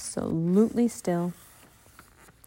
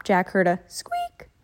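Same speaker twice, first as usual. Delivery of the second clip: usual speaking voice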